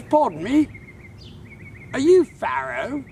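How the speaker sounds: put-on high British old-timer voice; British old man. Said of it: "this little British man".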